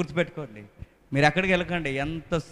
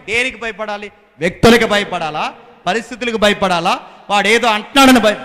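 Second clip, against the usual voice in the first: fervent preaching; 4 semitones higher, 9 dB louder than usual. Acted. Preaching with rising force.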